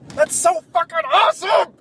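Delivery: bursting with fan joy; excited. Real, not acted. Fan gushing about his new tattoo line.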